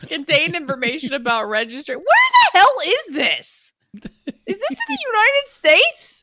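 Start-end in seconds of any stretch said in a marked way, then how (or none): 2.0-6.2 baffled. Real, not acted. Baffled by an unknown place.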